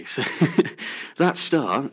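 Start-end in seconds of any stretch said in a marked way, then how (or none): 0.3-1.1 a quick airy chuckle at himself; short breathy laugh. Real, not acted. Radio host laughing at the star's silly name, short airy chuckle.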